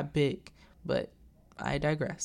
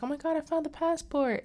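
contained shriek of triumph; excited mock scream; 12 semitones higher, faster than usual. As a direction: imitate a thrilled squeal. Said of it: "She's screaming".

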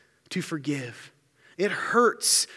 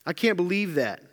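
mocking laughter; 3 semitones higher than usual. Preacher imitating people who mock a failure, with jeering laughs. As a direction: taunt them with fake laughter. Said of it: "People make fun of you and say".

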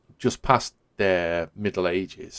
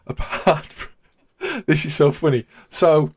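laughing through the words, barely getting them out; 8 semitones higher, 5 dB louder, much slower than usual. Real, not acted. Host laughing while telling a co-host about a show, laughing. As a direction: giggle as you start to explain. Laughing at the show's French characters.

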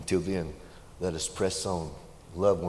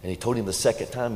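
normal talking voice

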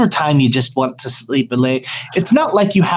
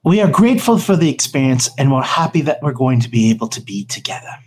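ordinary talk, nothing marked

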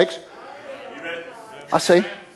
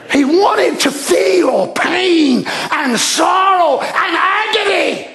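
high, impassioned pulpit cry; rising, emphatic sermon cadence; preaching; 7 semitones higher than usual. Acted. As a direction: preach it with full force to the congregation. Preaches at full force.

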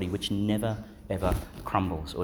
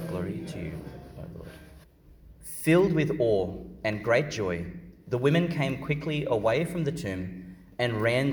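measured, solemn scripture reading; Gospel reading. Acted. Proclaims the Gospel at Mass.

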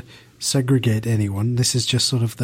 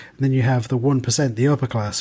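normal talking voice

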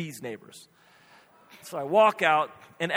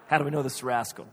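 quick repeat of a doubted question, pitched down; husband brushing off the doubt, fast and low; incredulous repeat; 3 semitones lower, faster than usual. Retold or imitated. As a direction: throw the question back quickly. Husband repeats her question back.

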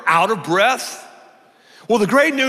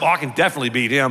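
low, quick confident aside; inner thought; 7 semitones lower, much faster than usual. Retold or imitated. Competitive rower eyeing an older man, quick low private thought. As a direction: toss it off fast and low, as if to himself. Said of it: "I'm saying to myself".

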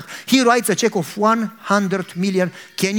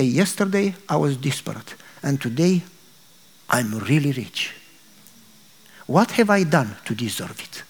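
humbled awe; 6 semitones lower than usual. Acted. Man humbled by gift.

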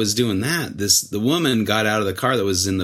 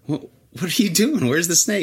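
higher, puzzled questioning; surprised; 9 semitones higher than usual. Retold or imitated. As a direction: ask like you can't believe they left. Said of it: "And I'm like".

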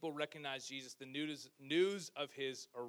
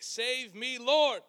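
much higher, louder desperate shout; shouted plea; 9 semitones higher, 14 dB louder, much slower than usual. A narrator's reading. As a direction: cry for rescue as you sink. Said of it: "he shouted".

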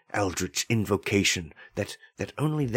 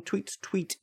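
high, soft tweeting; cat voicing the taunting bird, high and soft; chirpy bird; 11 semitones higher, 5 dB quieter than usual. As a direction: chirp it like a little songbird. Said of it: "It is saying".

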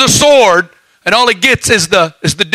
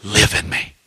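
much lower and softer, almost breathed; near hush; 10 semitones lower, 8 dB quieter than usual. Acted. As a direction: drop to a hushed plea. Sudden hush in sermon.

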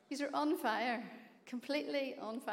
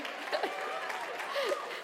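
breathy laugh; 13 semitones higher than usual. Real, not acted. Laughs at own boast.

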